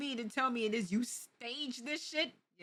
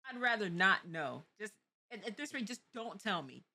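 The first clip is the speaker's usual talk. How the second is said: ordinary talk, nothing marked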